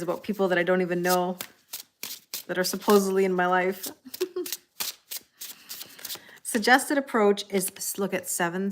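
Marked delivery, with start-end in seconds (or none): 3.9-4.5 high, soft giggle; short real giggle, kept quiet; chuckle. Real, not acted. Streamer amused by viewers' random stories, giggling softly.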